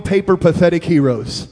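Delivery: ordinary talk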